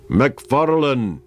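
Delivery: high, drawn-out formal call; somewhat loud, herald-like. A narrator's reading. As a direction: announce the name like a herald. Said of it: "he said somewhat loudly, more like a herald".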